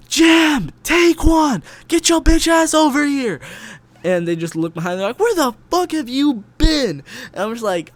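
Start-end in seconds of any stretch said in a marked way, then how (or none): 0.1-3.4 shouting across the distance, very high and loud; full-throated yell to find his friends; yelling names. Retold or imitated. Friend yelling for his boys across the street. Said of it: "I just remember yelling out their names". 5.2-7.0 loud, high startled yell; shouting back. Retold or imitated. Friends yelling at him where he has been.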